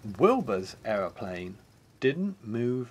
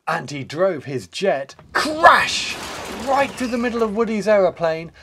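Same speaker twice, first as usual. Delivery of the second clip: louder, punchy crash; dramatic narration; 8 semitones higher, 6 dB louder than usual. A narrator's reading. Narrator landing the crash, loud and punchy. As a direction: hit crash hard.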